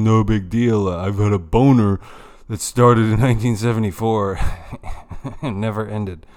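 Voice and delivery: a shitty, half-hearted Trump voice; Trump impression. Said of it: "accent fucking shitty impression was".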